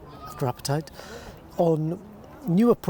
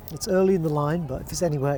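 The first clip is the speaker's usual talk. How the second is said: ordinary talk, nothing marked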